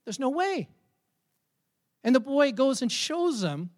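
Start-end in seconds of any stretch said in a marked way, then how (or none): 0.0-0.7 high-pitched, incredulous reply; disbelief. Acted. Father hears the puzzle is already done, incredulous.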